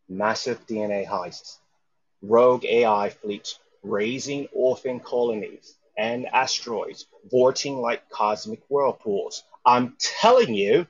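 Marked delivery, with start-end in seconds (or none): 2.2-10.9 growing louder, slowing on the last words; insistent build. Acted. Father insisting to his daughter on video, building loud and emphatic. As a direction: press the point harder and harder.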